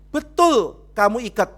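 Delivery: loud, high-pitched accusing question; acted interrogation. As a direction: fire the accusation at full volume. Acted pastor interrogates the husband.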